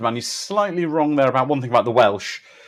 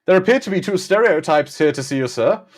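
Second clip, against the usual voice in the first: higher, mock German butler; bad German accent; 6 semitones higher, 4 dB louder than usual. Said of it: "who's bad at accents".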